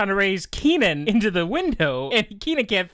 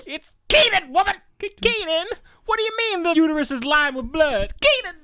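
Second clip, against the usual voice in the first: shouting mock Kel voice; 10 semitones higher than usual. Shouting Kenan and Kel imitation.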